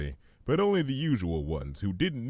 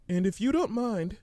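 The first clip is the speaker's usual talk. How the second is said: much higher, gentle pony voice; Fluttershy; 12 semitones higher than usual. Acted. Fluttershy answering the hummingbird, high and gentle.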